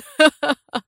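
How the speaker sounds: high, short derisive laugh; gloating laugh. A narrator's reading. Prisoner laughing at the commander, gloating. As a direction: laugh at his expense.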